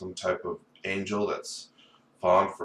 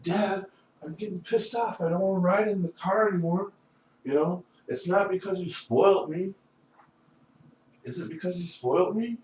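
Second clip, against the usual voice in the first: high, put-on son's protest; pitched-up mock argument; acted complaint to dad; 11 semitones higher than usual. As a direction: act out a son confronting his father. Acts himself pleading with dad.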